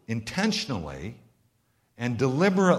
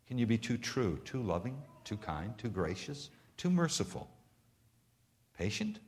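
quiet; 3 semitones lower, 10 dB quieter than usual. Real, not acted. Preacher drops to hushed reflection.

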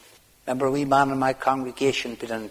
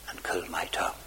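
whisper; 7 semitones lower, 9 dB quieter than usual. Acted.

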